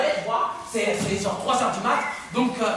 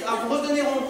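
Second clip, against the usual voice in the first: normal talking voice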